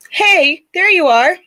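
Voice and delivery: high, loud delighted hello; lifted, pleased call of recognition; happy greeting. Real, not acted. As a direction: greet a friend who just reappeared. Greets friend whose connection returns.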